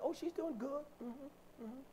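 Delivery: high, soft mock-agreeable voice; mimicked polite approval. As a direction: imitate people's pat reassurance with trailing mm-hmms. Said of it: "that's how we talk".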